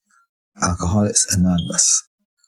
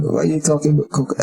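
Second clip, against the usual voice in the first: casual; 7 semitones higher, much faster than usual. Retold or imitated. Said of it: "he said it so casually".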